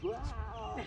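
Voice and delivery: high, mock dog bark; imitated dog outburst. Trainer mimics an unruly dog, high mock bark. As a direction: let out a high-pitched pretend "rah" like a dog. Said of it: "Unless you got a dog that's".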